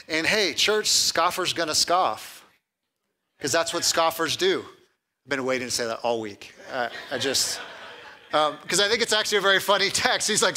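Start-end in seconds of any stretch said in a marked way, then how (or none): 5.2-8.8 laughing. Real, not acted. Pastor laughs at own joke.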